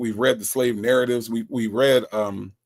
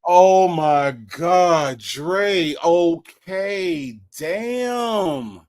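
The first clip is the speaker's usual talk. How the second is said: exasperated; 3 semitones higher, 3 dB louder, much slower than usual. Real, not acted. Exasperated host reacting to caller.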